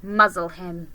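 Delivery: a touch of a cold witch; curt cruel command; cold witch order. Acted. Witch ordering the muzzle, lightly voiced. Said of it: "said the witch".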